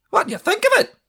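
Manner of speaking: high, loud eager Scottish artist; eager high Scottish painter asking the boy about his ghost. A narrator's reading. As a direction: ask it eagerly in a high Highland voice. Angel asks Harry.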